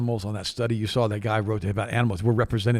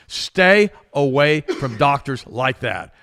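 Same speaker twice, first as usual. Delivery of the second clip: normal talking voice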